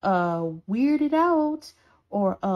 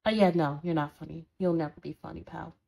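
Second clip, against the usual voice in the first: dry dismissal; 7 semitones lower, faster than usual. Acted. Roommate telling the other their joke failed, dryly dismissive. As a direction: shut the joke down, unimpressed.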